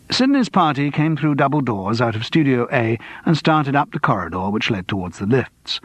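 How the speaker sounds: usual speaking voice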